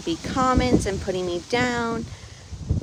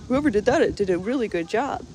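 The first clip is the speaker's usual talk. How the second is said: imitating stepdad's praise; 3 semitones higher, 5 dB louder than usual. Stepdad admiring the plumbing fix, mockingly imitated. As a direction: play up the stepdad's oblivious compliment. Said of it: "looks at me and he's like".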